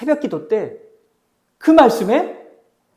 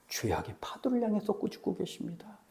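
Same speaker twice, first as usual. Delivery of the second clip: much softer, falling statement; hushed answer; 14 dB quieter than usual. Real, not acted. Preacher answering his own question for the congregation, hushed and certain. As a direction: drop the volume right down and state it as settled.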